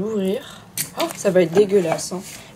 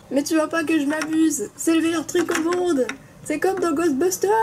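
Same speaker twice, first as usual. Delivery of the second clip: gleeful; 7 semitones higher than usual. Acted. Character enjoying slime.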